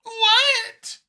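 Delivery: startled mouse crying out; surprised mouse. A narrator's reading. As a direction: jolt out a shocked squeak. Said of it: "The mouse looked surprised".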